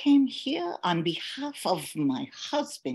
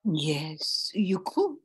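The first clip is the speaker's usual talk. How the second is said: whisper; 5 dB quieter, slower than usual. Acted. Marion's hushed reply.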